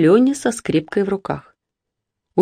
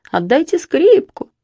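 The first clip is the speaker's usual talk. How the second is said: a mother imitating her crying son, higher and slow; tearful plea; 11 semitones higher than usual. Mother retelling her son's crying plea, higher and slow. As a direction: lightly mimic a child begging through tears. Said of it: "а он в слёзы, плачет".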